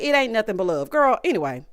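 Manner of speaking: usual speaking voice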